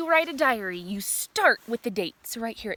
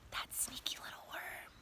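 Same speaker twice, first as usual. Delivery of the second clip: gasp, then hushed whisper; breathless whispered tease; 16 dB quieter than usual. Real, not acted. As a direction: whisper it like a secret.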